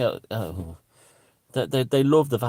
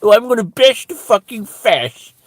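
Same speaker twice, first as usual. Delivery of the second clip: high, loud mock posh English; hammed-up posh accent, rising to a squeal; 11 semitones higher, 8 dB louder, slower than usual. Comedian mimicking posh activists, mocking upper-class accent. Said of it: "I always hear it with a posh upper accent".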